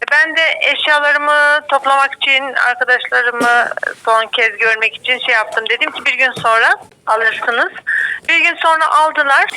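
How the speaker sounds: usual speaking voice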